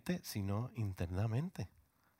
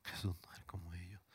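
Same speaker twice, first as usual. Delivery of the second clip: whispered repeat, breathy and hushed; hushed sermon whisper; 4 semitones lower, 12 dB quieter, faster than usual. Shown as a demonstration. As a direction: drop to a whisper for emphasis. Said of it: "repeated as whisper".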